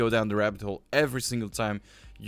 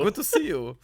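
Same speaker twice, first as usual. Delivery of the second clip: mock character greeting; 8 semitones higher than usual. Echoes the put-on greeting.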